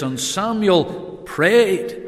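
slow, weighty stress on "prayed". Shown as a demonstration. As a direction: lean hard on "prayed", slow. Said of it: "just emphasizing that word there".

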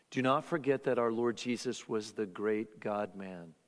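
ordinary talk

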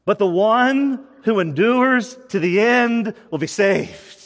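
slow, weighty reading of "endures"; slow emphatic reread. Shown as a demonstration. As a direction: lean on "endures" and draw the verse out. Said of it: "let me read that one more time".

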